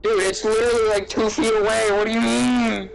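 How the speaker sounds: high-pitched, incredulous complaint; exasperated protest. Real, not acted. Speaker disputing the phone distance, exasperated high protest. Protests about the audio problem.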